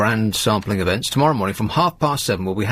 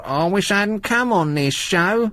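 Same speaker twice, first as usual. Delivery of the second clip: mock snarl; 8 semitones higher than usual.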